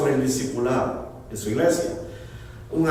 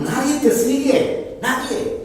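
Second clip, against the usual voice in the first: "Nadie" pitched higher and pressed hard; emphatic nobody; 9 semitones higher, slower than usual. Acted. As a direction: hit "Nadie" with a pointed sting. God confronts the pastor.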